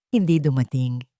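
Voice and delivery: ordinary delivery, nothing marked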